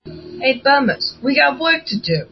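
breaking-up call. A narrator's reading. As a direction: break up the fight. Kid Blink breaking up scuffle.

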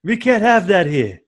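an exaggerated Yiddish accent.

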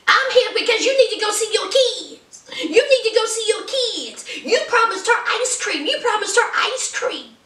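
high nagging woman's voice; insistent high scolding; mock Sarah. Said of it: "here is Sarah".

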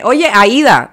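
light costeño "Oye, Aida"; costeña accent. Retold or imitated. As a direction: greet Aida like a woman from the Colombian coast. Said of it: "a una costeña decirme".

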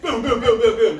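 imitated banging on the stall door; pounding door knocks.

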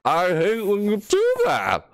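slow, high exasperated cry; high frustrated yell. Real, not acted. Gamer yelling at the doll who shut the lights, high slow wail. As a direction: wail out your annoyance at the doll.

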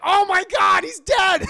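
very high, very loud mock shock; horrified shout. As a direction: shriek it in exaggerated horror. Onlookers react to death.

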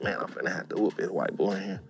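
hushed aside to himself; very soft private resolve; whispered thought. Retold or imitated. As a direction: whisper it under your breath. Own thought while lying in dark.

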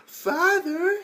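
high hopeful hammed-up call; mock happy daughter. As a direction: call out to a long-lost dad. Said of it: "She's like".

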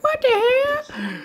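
very high, slow falsetto; mock disbelief. Streamer voices puzzled viewers, high mock disbelief. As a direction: squeak it out as a baffled viewer. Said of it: "you're probably gonna be like".

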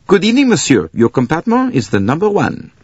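put-on high, eager accented official; deferential conductor. A narrator's reading. Conductor greets Bouc at the station, high and deferential.

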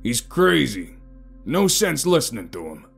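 gruff impatience; scornful dismissal. A narrator's reading. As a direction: dismiss him loudly. Scowling large man dismisses the stranger.

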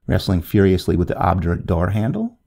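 usual speaking voice

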